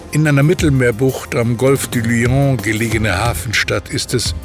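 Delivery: ordinary talk, nothing marked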